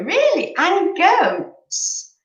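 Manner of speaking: real shocked squeal at a viewer's goats; astonished. Real, not acted. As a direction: shriek your disbelief at the goats. Reacting to chat about goats.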